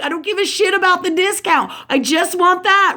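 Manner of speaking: high-pitched, heated retold retort; exasperated insistence. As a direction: re-enact insisting on what you want. Retold drive-thru quarrel.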